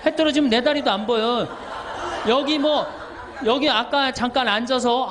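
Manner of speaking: laughing while telling it, loud. Real, not acted. Priest laughing to the congregation about pitch-dark nights.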